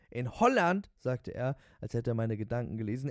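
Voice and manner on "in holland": high, loud, reverent enthusiasm; raving cabbie. Acted. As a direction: announce it as the promised land. Voices the raving taxi driver.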